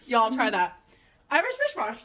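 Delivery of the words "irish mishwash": "Irish wristwatch" collapsing into "Irish mishwash"; higher, fumbled "Irish wristwatch" with sh sounds; tongue-tied. Real, not acted. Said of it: "I can't do it".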